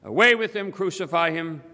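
high, loud crowd cry. A narrator's reading. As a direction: call out as an angry mob. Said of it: "And they cried out".

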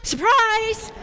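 high, loud, drawn-out surprise; mock surprise shout. Storyteller springs a surprise on the children, exclaimed high and loud. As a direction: burst out with a big surprise.

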